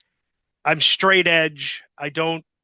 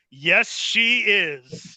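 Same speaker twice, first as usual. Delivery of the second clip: slow, drawn-out, higher smug answer; smug reply; 4 semitones higher, much slower than usual. Retold or imitated. Young wrestler answering the boss on the phone, slow and smug. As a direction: savour every word, very pleased with yourself. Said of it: "looking at Sherry in my bed going".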